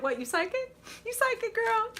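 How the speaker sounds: high, laughing banter; amused teasing. Real, not acted. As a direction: tease a friend through a laugh. Teasing a viewer who guessed right.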